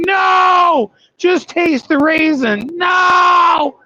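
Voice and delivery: high, loud hammed-up protest; mock shouted refusal. Trucker fighting off the cookie temptation, high mock shout. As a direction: shout them down in playful panic.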